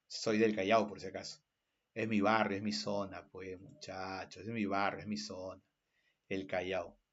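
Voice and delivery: low, very soft, trailing murmur; hushed aside. Real, not acted. Teacher tells students it's his own barrio, hushed low aside. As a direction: drop down low and confide it.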